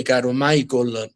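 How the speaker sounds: ordinary delivery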